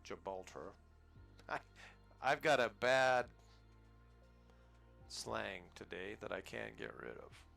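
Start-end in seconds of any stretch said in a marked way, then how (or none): none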